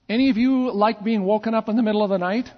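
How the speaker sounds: normal talking voice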